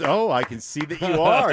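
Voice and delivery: high, loud amused reply; lady's reply. Retold or imitated. Dog owner answering the proud boy, amused and high. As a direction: play the charmed lady, laughing. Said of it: "and she goes".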